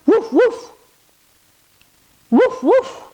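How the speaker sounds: high, quick little woofs; dog woof. Pretend. Reader in dog pose with kids, high playful woofs. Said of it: "Give me a woof".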